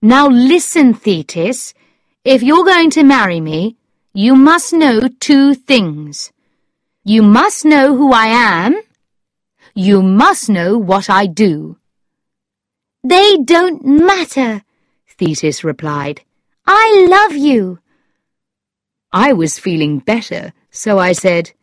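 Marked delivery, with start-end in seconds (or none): 0.0-11.8 raised, emphatic character voice; louder, insistent storybook suitor; Lord Struve, insistent. A narrator's reading. 13.0-14.6 put-on high, slow, louder girl's voice; Thetis, eager. A narrator's reading. 16.7-17.8 very high, slow, loud declaration; Thetis's declaration. A narrator's reading.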